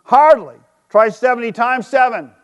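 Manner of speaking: a teacher's scoffing correction; Jesus replying. Acted. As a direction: scoff at the small number, then top it. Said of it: "Jesus replied".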